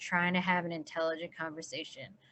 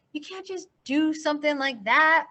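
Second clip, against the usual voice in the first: a touch of sore impatience; 7 semitones higher than usual. Acted. Sally snapping at Holden's runaway plan. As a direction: push back, higher and a little sharp. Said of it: "She sounded sore as hell".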